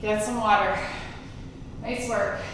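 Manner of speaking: ordinary delivery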